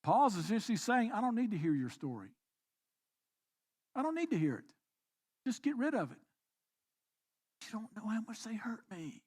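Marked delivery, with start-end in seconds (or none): 1.1-9.2 softer, curt brush-off; quiet dismissive voice. Pretend. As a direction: wave their story away quietly. Voicing Paul, then the listener.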